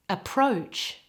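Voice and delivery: "Approach" with all the weight on PROACH; teacher's careful "Approach", stressed at the end; stress on second syllable. Shown as a demonstration. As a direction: model "Approach", light first syllable, full gliding O in the second. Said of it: "all of the emphasis in this word is on the second syllable".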